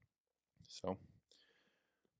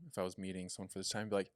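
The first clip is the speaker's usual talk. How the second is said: usual speaking voice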